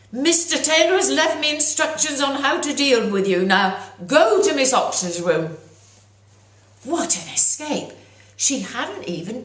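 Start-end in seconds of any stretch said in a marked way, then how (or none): 0.1-5.6 high, loud scolding schoolmistress; stern teacher. Acted. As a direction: send him out with cold authority. Teacher ordering boy out.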